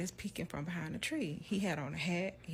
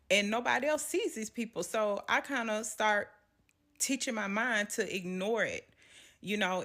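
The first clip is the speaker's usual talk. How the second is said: normal talking voice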